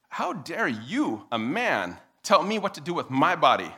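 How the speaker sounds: slow, emphatic mock indignation; indignant objection. Woman objecting to a man, deliberately indignant. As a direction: spell out the outrage word group by word group. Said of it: "here's the next obli-, uh, objection".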